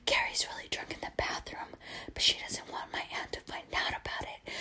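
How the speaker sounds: secretive hushed confession; voiceless, barely audible whisper; retold whisper. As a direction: whisper so nobody else hears. Cousin secretly confessing to aunt.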